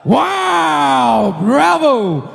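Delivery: booming ring announcer hype. Acted. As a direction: pump up the crowd. Announcer hypes the studio crowd.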